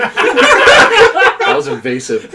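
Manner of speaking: laughing out the words, drawn out. Real, not acted. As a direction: cry it out mid laugh, slowly. Shocked laughing reaction.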